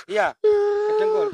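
high, slow, tearful answer; crying. Real, not acted. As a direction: sob out the words. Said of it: "Kok Anda nangis?". (menangis terisak)

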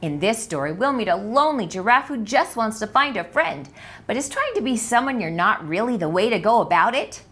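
ordinary delivery

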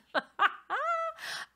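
helpless high laugh near tears; tearful high laugh; high crying laugh. Real, not acted. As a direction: laugh till it almost cries. Laughing at bath story.

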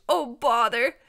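loud dragon bluster; exasperated allergic dragon. A narrator's reading. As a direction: blurt it out between sneezes.

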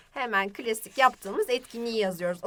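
ordinary delivery

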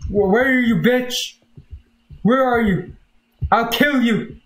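high yelled threats at an intruder; berserk rage. Real, not acted. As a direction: hunt him down, screaming threats. Threatening unseen intruder in game.

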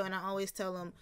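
ordinary delivery

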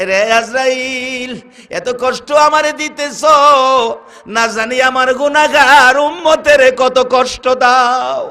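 high, loud, long-drawn chanted cry; soaring sing-song sermon lament; anguished Prophet, preached. Acted. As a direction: wail the name out in acted agony. Pained Prophet cries to Azrael.

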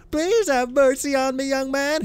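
put-on high-pitched pleading old beggar; crying beggar. Beggar begging the rich novice, high pitiful cry. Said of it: "he cried".

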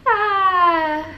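high, loud laugh ending in a long "ah"; real laughing fit with a drawn-out high wail; helpless laughter. Real, not acted. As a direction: laugh hard, then let out a high sigh. Laughing at friend's teasing.

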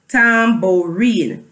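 "Tambourine" slowly as tam, bou, rine; broken into syllables. Shown as a demonstration. Teacher leading the class through the word, "Tambourine" syllable by syllable. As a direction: give each syllable of "Tambourine" its own beat. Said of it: "How many do we see?".

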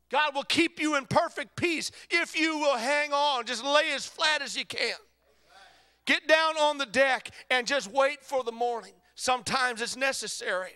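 loud, high preacher's cry; full-force preaching. Real, not acted. Preacher urging the congregation to hang on, full-force preaching. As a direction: preach it out at the top of your voice.

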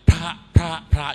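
"พระ" hit hard and loud each time; mock newsreaders' heavy, repeated "พระ"; mimicked newsreader mispronunciation. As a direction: punch every "พระ" as an overstressed syllable. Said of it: "แล้วทั้งอ่านข่าวทุกวันนี้ยังพระ".

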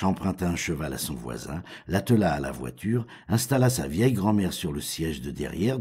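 usual speaking voice

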